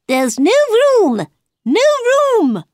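high, loud cry of protest. A narrator's reading. Hare and Hatter to Alice at the table, crying out. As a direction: shout it out in alarmed chorus, very high. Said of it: "they cried".

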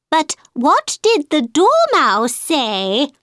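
high, slow juror question. A narrator's reading. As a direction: ask like a curious juror. Juror asks.

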